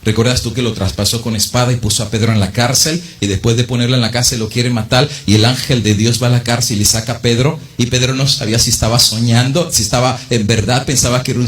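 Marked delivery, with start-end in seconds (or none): none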